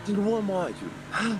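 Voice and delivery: high-pitched, playful disbelief; mock incredulity. Driver teasing a skilled rival called Noob, mock incredulous. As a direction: act affronted, voice pitched well up.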